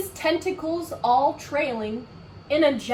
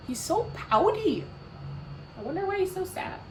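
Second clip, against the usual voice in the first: usual speaking voice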